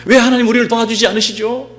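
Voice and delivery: high, loud pleading protest; loud complaint. Acted. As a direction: cry out the people's grievance to God at full voice. Acts out people's complaint to God.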